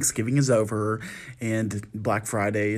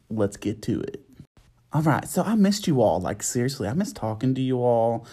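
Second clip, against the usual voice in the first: normal talking voice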